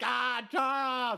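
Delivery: high, slow, stretched call for help; hammed-up stuck and helpless cry; mock cry for help. Stuck on the counter, calling his friend for help, mock-panicked. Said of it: "I'm just like".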